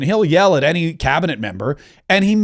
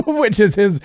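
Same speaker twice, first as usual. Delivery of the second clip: laughing through words; words broken by a laugh; 4 semitones higher than usual. Real, not acted.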